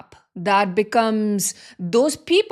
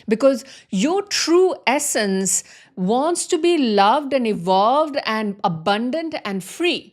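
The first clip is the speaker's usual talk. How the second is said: ordinary talk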